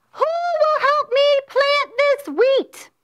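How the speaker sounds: high, loud hollered call; hen hollering. Acted. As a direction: holler it out across the yard like the hen. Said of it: "So I hollered out".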